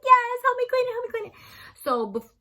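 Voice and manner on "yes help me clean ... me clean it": high, loud "Yes", then urgent begging; frantic pleading. Retold or imitated. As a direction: beg for help before mom arrives. Frantic plea repeated.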